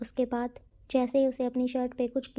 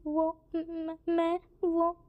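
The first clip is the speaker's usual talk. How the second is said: crying; 5 semitones higher, much slower than usual. Acted. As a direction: sob out the broken words. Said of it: "Chang रोते हुए बोलता है". (रोते हुए, हकलाते हुए)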